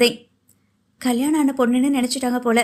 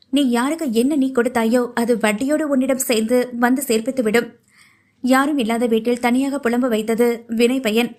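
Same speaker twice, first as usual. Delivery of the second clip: ordinary delivery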